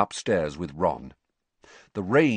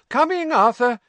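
high, loud call to another room; mother calling to her husband in the kitchen, high and loud; 7 dB louder than usual. A narrator's reading. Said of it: "called Missus Weasley".

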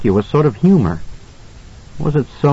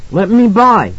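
put-on frightened little boy; 10 semitones higher, 3 dB louder than usual. A narrator's reading. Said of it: "Danny said".

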